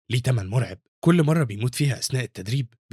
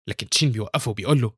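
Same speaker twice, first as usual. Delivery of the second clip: plain reading voice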